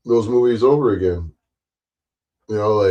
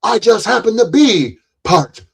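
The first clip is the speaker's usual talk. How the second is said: much higher, animated character exchange; acted movie dialogue; 13 semitones higher, 4 dB louder, slower than usual. Streamer replaying The Jerk scene, high acted voices. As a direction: trade the quoted lines in a high cartoonish voice.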